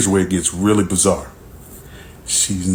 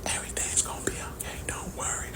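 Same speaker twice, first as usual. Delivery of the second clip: fast, voiceless, much softer whisper; 19 dB quieter, faster than usual. Retold or imitated. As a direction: brush him off in a quick whisper. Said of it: "all this whispering".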